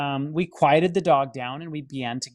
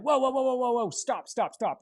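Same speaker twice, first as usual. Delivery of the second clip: alarmed whoa; 9 semitones higher, 3 dB quieter than usual. Retold or imitated. Hat flies across room during prayer.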